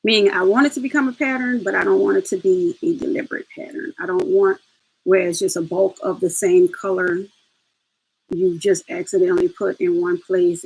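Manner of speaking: ordinary delivery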